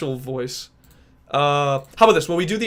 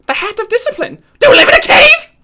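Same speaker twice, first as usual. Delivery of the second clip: very high, loud cartoon screech; Ralph impression; 12 dB louder than usual. Streamer keeps doing Ralph for chat, shrieking high. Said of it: "can I do Ralph from Ed, Edd n Eddy".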